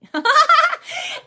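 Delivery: loud high burst of laughter; real laugh, high and loud; bright laugh. Real, not acted. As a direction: laugh out loud at your own joke. Laughs at own joke.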